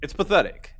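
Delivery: usual speaking voice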